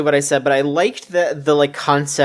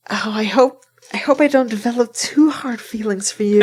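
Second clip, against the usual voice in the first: higher, whispery mock of a bashful girl; mock shy girl; 5 semitones higher than usual. Mocking a game heroine's confession, in a higher whispery voice. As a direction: mimic her breathy confession, giggling. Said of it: "she's like".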